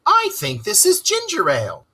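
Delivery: high, pleased remark; approving Rat. A narrator's reading. Rat examining a bottle, high and approving. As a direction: sound pleased with the find. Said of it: "he remarked approvingly".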